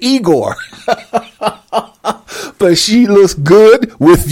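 talking through laughter; words breaking into giggles; laughing. Real, not acted. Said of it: "laughing through lines".

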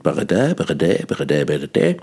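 "Bredä" with the stress on the last syllable "dä", playful; singsong French-style end stress on "bredä"; mock French stress. Shown as a demonstration. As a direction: parody of Romance stress. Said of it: "die Endsilbe betont".

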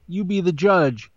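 normal talking voice

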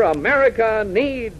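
normal talking voice

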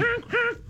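shrieking high-pitched cackle; wild high cackle. Real, not acted. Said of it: "such a contagious laugh".

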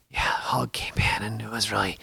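hushed hunter's whisper; whisper to camera. Retold or imitated. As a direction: whisper like you're still hunting. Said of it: "I'm whispering to the camera".

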